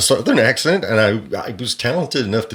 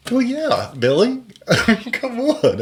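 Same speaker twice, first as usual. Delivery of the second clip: mock exasperation; slower than usual. Real, not acted. Mocking reply to Billy Bob.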